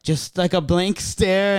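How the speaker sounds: normal talking voice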